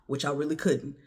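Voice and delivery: usual speaking voice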